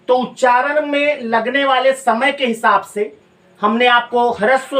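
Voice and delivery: normal talking voice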